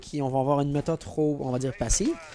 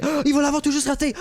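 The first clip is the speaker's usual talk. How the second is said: surprised exclamation; 10 semitones higher, 5 dB louder than usual. Real, not acted. Clutch attempt narrowly misses.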